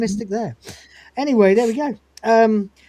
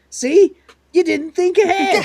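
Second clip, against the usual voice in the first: high, loud gloating cat voice; mock cat taunting; 9 semitones higher, 5 dB louder than usual. Cat gloats at the dentist for not planning, high and loud. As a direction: crow it at him like a smug cat.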